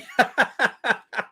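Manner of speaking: bursting into a high laugh; can't hold back the laugh; real laugh. Real, not acted. Laughing at her insult.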